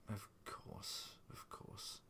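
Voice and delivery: very soft, hushed reassurance; soothing. A narrator's reading. Husband pats his crying wife, soothing. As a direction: soothe gently under the breath. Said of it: "he patted her".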